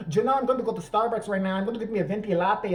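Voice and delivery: imitating her put-on Spanish accent; fake Spanish accent. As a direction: order coffee in a mock Spanish accent. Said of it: "she loses the accent".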